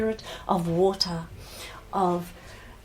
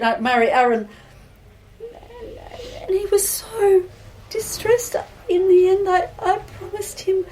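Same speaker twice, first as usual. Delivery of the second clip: high, halting, tearful voice; distressed bride confessing; 7 semitones higher than usual. Acted. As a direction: confess haltingly, close to tears.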